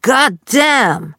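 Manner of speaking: „God damn!“ laut und höher, Südstaaten-Akzent; lauter Fluch. Retold or imitated. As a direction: ein Vater, der wütend durchs Haus flucht. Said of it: "hörte ich ein lautes". (lautes, höheres Südstaaten-„God damn!“)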